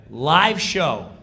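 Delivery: normal talking voice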